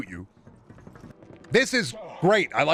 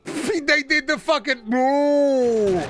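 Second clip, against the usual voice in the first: excited ooh; 13 semitones higher, 4 dB louder, much slower than usual. Real, not acted. Reacting to a cutscene.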